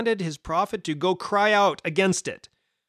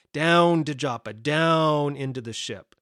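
DOWN drawn out at the start of each phrase; stress on DOWN. Shown as a demonstration. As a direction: lean hard and long on the word Down. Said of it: "repeated emphasis on the word down here".

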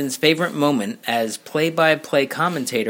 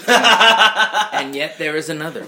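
laughing; 5 semitones higher, 6 dB louder, slower than usual. Real, not acted. Host laughing after a joke.

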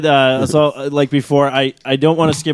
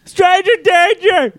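high, drawn-out warning cry; hammed-up high-pitched shout; mock alarm yell; slower than usual. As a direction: holler a slow mock warning.

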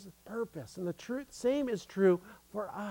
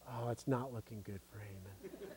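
murmured under the breath; quiet aside; 9 semitones lower, 17 dB quieter than usual. Real, not acted. Quiet aside about Haman.

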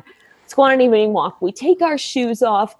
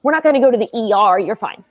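fast dismissive rattle; much faster than usual. Retold or imitated. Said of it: "I was like".

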